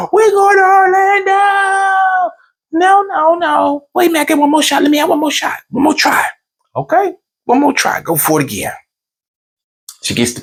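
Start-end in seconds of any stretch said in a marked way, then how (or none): 0.1-2.3 high slow shouted wife cheer; slow high victory shout as the wife; wife's cheer. Retold or imitated. Wife celebrating. 2.7-6.3 high pleading wife voice; high-pitched pleading wife. Retold or imitated. Pleading for another shot. 6.7-8.7 own quote. Retold or imitated. Granting another shot.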